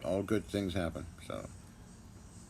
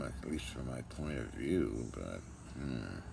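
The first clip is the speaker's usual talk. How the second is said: trailing off; 6 semitones lower, 7 dB quieter than usual. Real, not acted. Musing aloud, voice dropping away.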